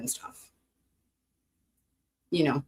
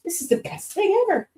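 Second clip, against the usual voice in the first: much higher, gushing; delighted. Real, not acted. Trying the massager live, a high gushing delight. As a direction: gush with real delight.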